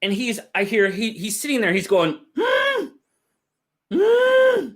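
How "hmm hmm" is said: high, nasal mock whimpering hum; squeaky whimpering hums, half-joking; imitated whimper. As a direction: whimper like a scared new kid. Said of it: "He's, like, whimpering".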